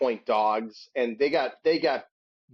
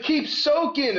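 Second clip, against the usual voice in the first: heckler taunt; 12 semitones higher, slower than usual. Retold or imitated. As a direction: jeer it across the tailgate. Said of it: "they're like".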